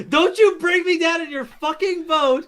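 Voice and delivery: high, slow, deliberate refusal; indignantly disown it, spacing out the words; emphatic protest. Real, not acted. Refusing to share the blame.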